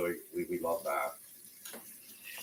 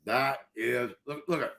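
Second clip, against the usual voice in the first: talking with a mouth full of fish; 5 semitones higher, 8 dB louder than usual. Real, not acted. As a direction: speak through a mouthful of fish. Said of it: "I have my mouth full of fish".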